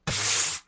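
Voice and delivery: voiceless puff of air; blowhole exhale. Acted. Whale-like minister hearing a smuggler's name, scornful exhale. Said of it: "exhales loudly through his blowhole".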